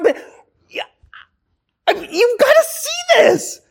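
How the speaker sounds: high excited appeal; eager plea. Retold or imitated. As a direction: beg them to look, bursting with excitement. Eager believer pleading with synagogue.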